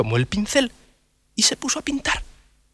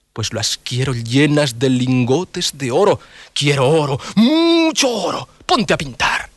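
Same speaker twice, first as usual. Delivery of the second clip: greedy emperor; slower than usual. Acted. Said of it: "el emperador le dijo". (emperador avaro con voz grave)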